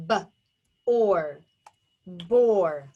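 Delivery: "b", then "or", then blended "bore"; segmented phonics demo of bore; tapped out. Shown as a demonstration. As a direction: sound out B, OR separately, then join as BORE. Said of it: "Tap it out".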